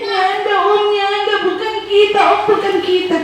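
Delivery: put-on high, wailing child's plea; sobbing high denial to the stranger; crying child. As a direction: whimper it like a scared boy. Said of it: "Andi so menangis".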